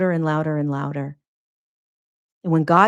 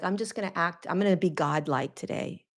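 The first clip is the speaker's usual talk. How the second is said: mock self-righteous; 7 dB quieter than usual. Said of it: "You can't walk outside and be like".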